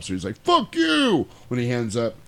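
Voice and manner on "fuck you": high-pitched retold outburst; mock angry yell. Imitating the sheriff on the phone with state police, mock outburst. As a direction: imitate a fed-up sheriff cursing.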